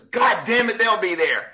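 exasperated retold yell; retold angry coach. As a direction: imitate a coach cussing out the ref. Retold coach snapping at referee.

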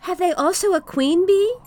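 put-on high, loud curious child; little boy voice. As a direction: ask like an eager little boy. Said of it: "asked the little boy".

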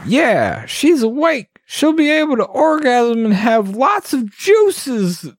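high-pitched goblin exclamation; excited goblin guard, voice pushed up high; exclaiming goblin guard. Acted. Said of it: "Goblin Guard exclaimed".

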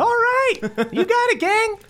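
very high, squeaky cheerful greeting; high-pitched chirpy scoutmaster; chipper Scoutmaster Denny. Acted. Denny eagerly takes the order.

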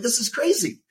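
high incredulous exclamation; disbelief. Retold or imitated. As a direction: pitch it up in mock disbelief. Retold disbelief.

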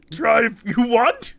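a goofy backwards-wise Yoda; hammed-up little sage voice; Bizarro Yoda. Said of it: "You're like Bizarro Yoda".

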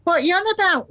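high, sharp "what you on about"; indignant retort. Retold or imitated. Husband scoffs back at his wife, high and indignant. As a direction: brush off her accusation, high and sharp. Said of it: "he said".

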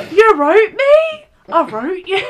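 high, slow, hammed-up Bromley impression; Bromley accent, stretched and breaking into laughter. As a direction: act out a dubbed romance in Bromley. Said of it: "I think the Bromley one is".

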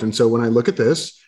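ordinary talk

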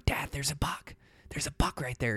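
whispered urgently, no voice; hushed whisper. Retold or imitated. Boy whispering to his dad about a buck, urgent whisper. Spotting a buck while hunting.

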